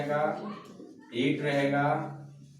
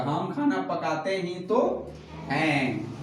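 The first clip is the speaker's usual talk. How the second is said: slow teaching read leaning on ही तो; emphatic ही तो; slower than usual. Shown as a demonstration. Teacher reading the example aloud to class, slow and stressed. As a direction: slowly stress the words ही तो. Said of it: "एक जोर दे के बोला जा रहा है".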